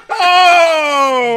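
high, loud cry of disbelief; real stunned laughing yell; shocked laughing outcry. Real, not acted. Shocked reaction, laughing and crying out.

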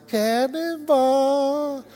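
high, long-held call without full volume; mock cannonball yell. Believer going into the world, drawn-out mock yell. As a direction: stretch it out like a restrained holler. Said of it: "go into a world and yell".

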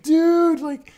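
very high, loud stunned cry; imitated astonishment. Fan reliving Tobey coming out, high-pitched mock astonishment. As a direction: shoot way up in disbelief.

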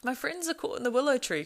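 understated distressed cry; breathless cry. A narrator's reading. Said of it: "cried Frodo breathlessly".

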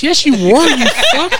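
high, loud, mocking self-scold; inner voice scolding. His own brain calls him out for lying, high mocking scold. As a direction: let your brain call you out.

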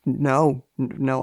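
usual speaking voice

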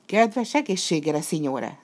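ordinary delivery, nothing marked

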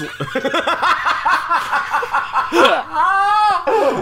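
high shrieking laughter; loud laughing fit. Real, not acted. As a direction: howl with laughter.

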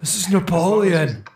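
lightly voiced nerdy teen; Napoleon Dynamite voice. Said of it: "Napoleon Dynamite gonna call into the show".

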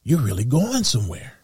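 lightly voiced awestruck admirer; admiring listener. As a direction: gush like an impressed friend hanging on every word. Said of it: "you see their eyes light up like".

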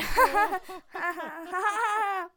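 high, drawn-out put-on laughter; fake laugh. Shown as a demonstration. Host does the laugh track herself, fake laughing. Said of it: "Or I can just do it".

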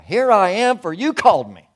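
put-on high, loud, eager boy calling out. Boy running to priest, eager and loud.

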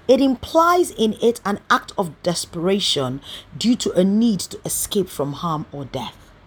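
ordinary talk, nothing marked

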